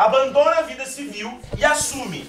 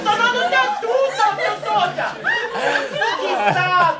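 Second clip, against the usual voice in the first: high, loud mock yell with T-for-everything speech impediment; hammed-up tongue-tied boy swearing at classmates; imitated garbled cursing; 12 semitones higher, 3 dB louder than usual. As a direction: yell insults, consonants collapsing into T. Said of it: "Tava xingando ele".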